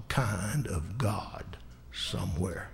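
a sudden slow whisper. Real, not acted. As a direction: lean in and breathe it like a secret. Preacher drops to a hush.

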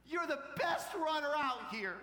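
high, loud burst of praise; proud shout. Retold or imitated. Dad praising his daughter who fell, proud shout. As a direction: cry it out with fatherly pride.

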